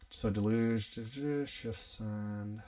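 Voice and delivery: soft, half-voiced skim-reading; reading to oneself. Real, not acted. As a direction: skim the text under your breath, filling gaps with syllables. Skim-reading chat to himself.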